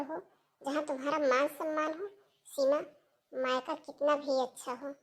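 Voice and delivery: soft, understanding counsel; tender reassurance. Acted. Sister-in-law reassuring Seema about remarrying, tender. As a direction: coax her gently, keeping the voice quiet.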